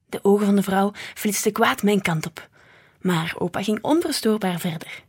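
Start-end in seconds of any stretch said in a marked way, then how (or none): none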